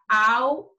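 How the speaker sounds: "ao" said as a separate "al", rising and held; wrong syllable split. Shown as a demonstration. Teacher showing the wrong division of alho, detached syllable. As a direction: isolate the first chunk "al", then stop. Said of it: "não vai ficar".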